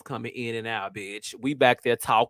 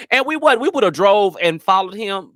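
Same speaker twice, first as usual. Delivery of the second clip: excited admission; 6 semitones higher, 5 dB louder than usual. Real, not acted. Admitting they'd have followed.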